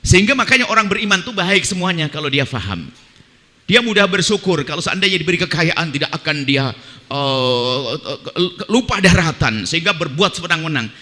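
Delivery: usual speaking voice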